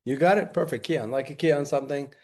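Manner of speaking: normal talking voice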